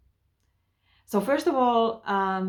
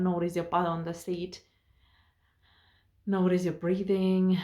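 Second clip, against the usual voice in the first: ordinary talk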